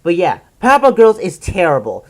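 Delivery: normal talking voice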